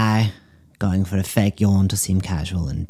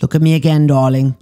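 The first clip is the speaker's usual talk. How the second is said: high, loud psychic command; calling out; 7 semitones higher, 7 dB louder than usual. Acted. Psychic commanding the meerkat to look at her, loud call. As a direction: call to the meerkat, much higher and louder.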